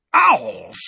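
very high, loud outburst; outraged yell. Acted. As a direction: explode on one drawn word.

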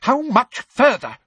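high, loud, shrill-free demand; angry demand. Acted. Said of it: "demanded Umbridge angrily".

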